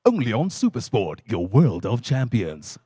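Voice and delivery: deep Supersport announcer voice; sports ad voiceover. Shown as a demonstration. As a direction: deliver it like a TV sports promo. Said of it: "on ads like".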